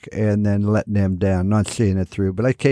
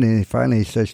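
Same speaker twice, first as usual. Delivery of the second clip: normal talking voice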